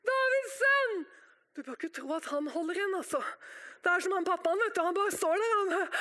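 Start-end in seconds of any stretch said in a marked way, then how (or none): 0.1-1.1 high, drawn-out plea for mercy; slow pleading holler; crying out. Acted. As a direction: call to heaven, holding the words long. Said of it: "Og du roper".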